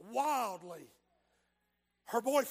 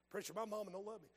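sad listener reply; 2 semitones lower, 10 dB quieter, much faster than usual. Retold or imitated. Preacher voices a listener's reply.

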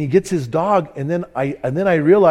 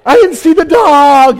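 high, loud, slowed defensive cry; loud protest; 10 semitones higher, 11 dB louder, slower than usual. Retold or imitated. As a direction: plead your innocence at full volume. Speaker defends himself, retold.

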